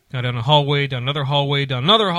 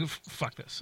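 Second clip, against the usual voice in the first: whispered curse; 17 dB quieter, slower than usual. Retold or imitated. Fed up in line, muttering.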